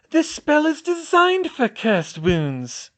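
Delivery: high, slow cry. A narrator's reading. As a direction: cry out in frustration. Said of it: "she cried".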